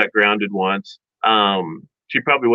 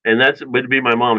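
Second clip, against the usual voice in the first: ordinary talk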